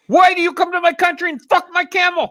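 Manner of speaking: very high, hammed-up indignation; put-on hysterical outrage; mock outraged rant. Taunting a scam caller on the phone, mock outrage.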